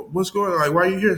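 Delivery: high-pitched questioning, imitated; office lady. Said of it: "like, the office lady".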